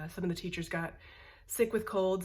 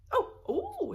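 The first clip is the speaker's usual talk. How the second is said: surprised; 4 dB louder than usual. Real, not acted. Discovering the pump on bottle.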